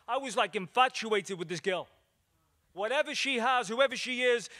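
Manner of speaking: ordinary talk, nothing marked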